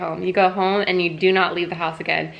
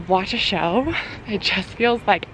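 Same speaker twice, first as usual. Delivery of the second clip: ordinary delivery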